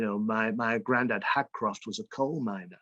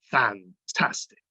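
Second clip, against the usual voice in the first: northern fantastic; slower than usual. Shown as a demonstration. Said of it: "Can you say fantastic?".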